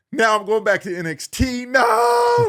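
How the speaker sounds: high, loud cry of despair; hammed-up panic, rising to a shouted no; panicked wail. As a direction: cry out as if your career just ended. Reliving panic at being demoted.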